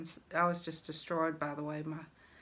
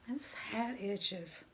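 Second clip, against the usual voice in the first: hushed, slow whisper; whispered aside; 3 semitones higher, 6 dB quieter, slower than usual. Real, not acted. Aside about itchy hat.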